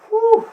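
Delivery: loud, high exertion whoop; effortful whew. Real, not acted. Coach feeling the overhead hold burn, loud strained whew. Exhaling hard during the hold.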